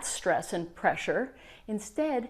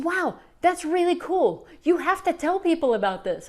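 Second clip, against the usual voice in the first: much higher, excited urging; thrilled; 8 semitones higher than usual. Retold or imitated. Client urging the coach to share it, thrilled. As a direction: bubble over with the discovery.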